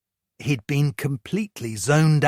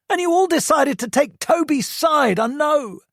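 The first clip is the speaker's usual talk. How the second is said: high-pitched, loud furious rant; angry teen rant; 4 dB louder than usual. A narrator's reading. Hurt son lists Toby's abuse to mum, furious and high. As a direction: pour out every grievance, voice pitched up. Josh's voice.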